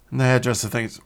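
ordinary talk

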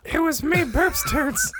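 squeaky high boast. Acted. Claims fake username proudly.

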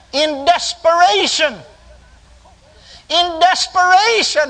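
high, slow, stretched-out emphasis; climactic preaching. Real, not acted. Preacher reaching the turning point of his vision, high climactic preaching. Climax of retold desperate moment.

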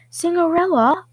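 high, loud call across the hall. Acted. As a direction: call her name out loud. Name called out at tryout.

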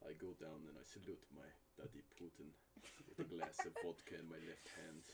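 low, quiet deep Russian voice; mock Russian, deep and soft; deep Russian accent. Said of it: "It's too deep for your little boy voice".

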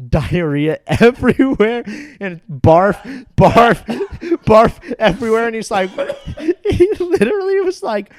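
high, loud, laughing through words; laughing while telling. Real, not acted. Telling the gross dorm story to a friend, laughing hard. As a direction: crack up as you tell it.

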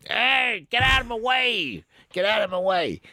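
high, yelled road-rage driver; hammed-up angry yell at other drivers; mock road-rage yell. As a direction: bellow at the traffic like an angry motorist. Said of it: "yelling and waving a cigarette around".

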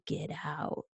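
hushed, low ghostly growl; demonic voice. Retold or imitated. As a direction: breathe it out like a demon. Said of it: "heard a demonic voice saying".

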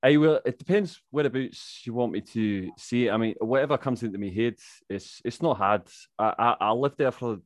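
put-on Scottish accent, chatty. Shown as a demonstration. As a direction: slip into a conversational Scots accent. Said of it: "That's my Scottish accent".

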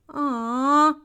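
storybook sigh of letdown; disappointed sigh. A narrator's reading. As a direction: let it sag in mild disappointment. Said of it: "sighed the children".

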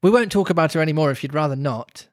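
usual speaking voice

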